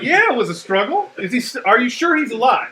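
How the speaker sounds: high, loud retold incredulity; loud disbelief. Sergeant answering Doc over a bloodied Marine, incredulous. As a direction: throw his excuse back at him, high and loud.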